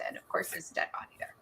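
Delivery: hushed, fast, like a secret; spooky whisper. Acted. Reader teasing the book club with the murder, whispered. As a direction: lean in and whisper the twist. Dramatic mystery aside.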